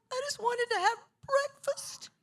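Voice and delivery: high, squeaky mock plea; bewildered complaint. Daughter swamped by fans at breakfast, bewildered complaint. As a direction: imitate an overwhelmed, helpless little whine.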